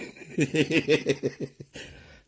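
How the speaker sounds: high, loud burst of laughter. Real, not acted. Streamer laughing loudly at their own gushing. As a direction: cackle out loud.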